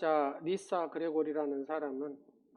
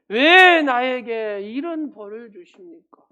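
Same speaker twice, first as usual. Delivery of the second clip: slow, much louder anguished cry at God with 왜 stretched out; wailing outcry; 4 semitones higher, 16 dB louder, slower than usual. Retold or imitated. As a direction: howl it out slowly like a desperate sufferer blaming God. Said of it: "하나님을 향해서 욕설을 퍼붓기도".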